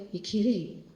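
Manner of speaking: normal talking voice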